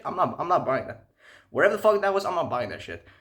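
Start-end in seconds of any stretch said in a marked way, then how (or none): none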